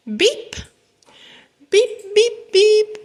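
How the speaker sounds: high, short horn beeps; high-pitched toy horn; little truck beeps. A narrator's reading. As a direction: beep like a small friendly truck. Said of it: "said Blue".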